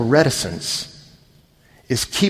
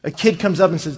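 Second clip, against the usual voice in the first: ordinary talk, nothing marked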